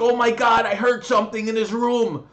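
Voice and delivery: hammed-up panicky neighbour; mock neighbour panic. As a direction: imitate her freaking out. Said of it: "she's like".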